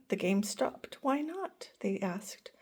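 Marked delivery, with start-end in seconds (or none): 1.0-1.7 hushed. A narrator's reading. Friends ask quietly.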